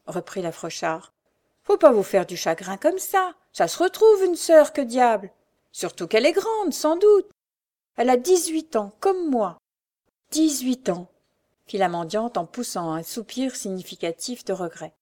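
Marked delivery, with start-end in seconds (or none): 1.6-11.1 high, loud, lively consoling chatter; talkative beggar woman. A narrator's reading. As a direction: console her briskly in a lifted voice. Beggar woman consoles, then regrets.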